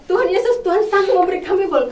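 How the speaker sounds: loud, high-pitched declaration; shouted prayer. Retold or imitated. Couple praying on the road for a car, shouting. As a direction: call it out boldly to God. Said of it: "Di jalan tuh teriak".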